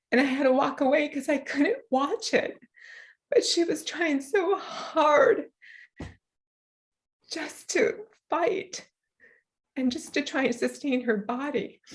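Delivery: fighting back tears as she remembers; choked up. Real, not acted. A mother near tears telling how her daughter fought to eat, voice breaking.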